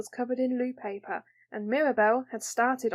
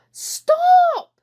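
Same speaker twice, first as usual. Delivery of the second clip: sharp high shout of alarm; alarmed cry; 11 dB louder than usual. Acted. Ellen crying out to stop Mirabel, loud alarmed yell. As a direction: yell it to stop her at once. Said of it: "cried Ellen".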